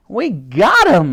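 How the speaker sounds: loud, high, drawn-out triumph; exclaiming. A narrator's reading. Dottie celebrates the collisions, exclaiming. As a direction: exclaim it with glee. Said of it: "exclaimed Dottie".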